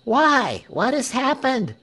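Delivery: high-pitched, eager question; crowd's cry. A narrator's reading. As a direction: press anxiously for the news. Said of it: "cried everybody".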